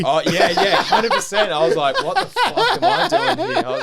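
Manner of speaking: loud, high laughing retelling. Real, not acted. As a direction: talk through real laughter, pitch climbing. Laughing along at guest's joke.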